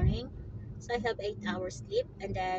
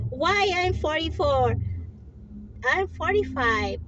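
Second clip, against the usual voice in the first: retold protest; 6 semitones higher, 6 dB louder than usual. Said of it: "And I was telling her".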